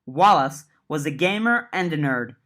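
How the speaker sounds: ordinary delivery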